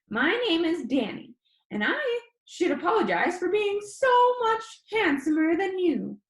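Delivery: high, squeaky, cocky boast; high squeaky boy. Acted. Danny jokes to his viewers in a high squeaky voice. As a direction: brag like a cheeky kid showing off. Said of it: "His voice was high and squeaky".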